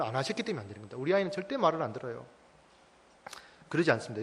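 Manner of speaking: ordinary talk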